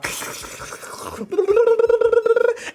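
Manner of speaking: long, high, exaggerated silly noise; high comic squeal. Streamer pretending to be the slime, high silly noise. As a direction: let out a high mock-excited trill.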